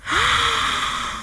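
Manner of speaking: long, soft high gasp; amazed gasp. Acted. Villagers realising it is the sea, amazed gasp. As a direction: gasp in wonder.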